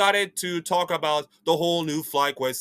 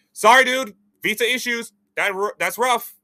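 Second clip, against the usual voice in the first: mock dismissive official; 7 semitones higher, 4 dB louder than usual. Mock official voicing visa rejection.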